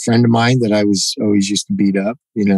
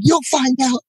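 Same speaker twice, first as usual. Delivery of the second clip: much higher, sing-song mocking taunt; gloating tease; much slower than usual. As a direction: throw his own line back at him gleefully. Teasing friend while slamming him.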